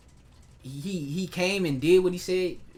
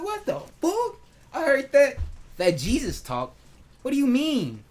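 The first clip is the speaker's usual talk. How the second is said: much higher and louder, stunned; shocked disbelief; 8 semitones higher, 3 dB louder than usual. Retold or imitated. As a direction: react as if you can't believe your ears. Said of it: "I'm like".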